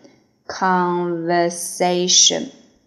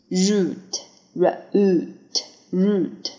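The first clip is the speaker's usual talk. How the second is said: slow drill; much slower than usual. Shown as a demonstration. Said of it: "vocabulary drill".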